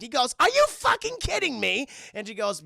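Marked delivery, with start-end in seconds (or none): 0.4-1.9 high, loud mock-outraged squawk; hammed-up disbelieving yell; incredulous outrage. Dwarf told to crawl into vent, incredulous outrage.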